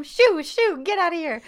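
high, loud mock order to leave; shooing them away. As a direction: stomp at them and shout them off. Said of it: "she stomps at them".

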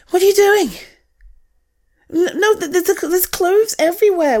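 high, loud what are you doing; shocked at the mess; startled. Real, not acted. Walking in on her friend's mess, high startled call. Sees clothes everywhere.

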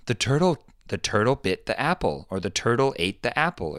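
lifted pitch, mild wonder after a gasp; telling a child. Acted. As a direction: point it out to a small son. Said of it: "go to my son and say".

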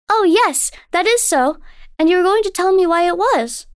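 put-on high, wondering child; a little girl full of awe; young girl voice. A narrator's reading. Daughter tells her mother about the moonlit lake, in wonder.

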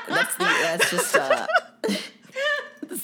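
words broken by laughter; giggling through the line; laughing. Real, not acted. Laughing after botched Donald attempt.

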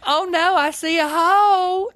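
very high, slow southern lady squeal. Retold or imitated. Retelling the movie to a friend, high slow [name] squeal. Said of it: "and then she goes".

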